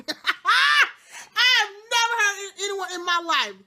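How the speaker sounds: high and loud through laughter; real laughing disbelief at the grace scene. Real, not acted. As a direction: laugh it out, can't believe it.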